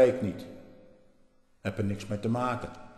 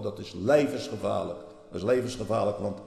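ordinary delivery

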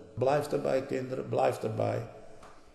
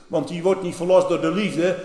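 ordinary talk

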